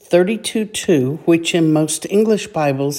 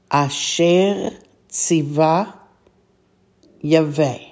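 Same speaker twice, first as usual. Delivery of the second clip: slow, drawn-out Hebrew phrase; slow Hebrew reading. Shown as a demonstration. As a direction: read it slowly, each word apart. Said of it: "all together".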